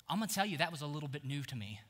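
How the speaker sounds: ordinary talk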